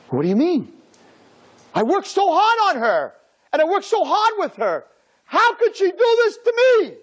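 high, loud, aggrieved whine; mock wounded teacher. Teacher complaining to the rabbi, high and aggrieved. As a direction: sound personally betrayed.

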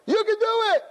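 high, loud rallying cheer; cheering crowd. Retold or imitated. Crowd cheering the walker before the unicycle ride, loud high cheer.

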